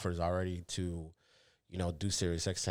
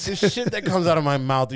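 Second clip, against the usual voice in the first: emphatic exclaim; 8 semitones higher, 10 dB louder than usual. Real, not acted. Loud emphatic self-mocking exclaim.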